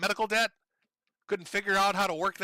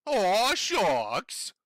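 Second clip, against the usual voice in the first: mock aw-shucks voice; 2 semitones higher, 4 dB louder than usual. Mocking Boogie's fake innocence.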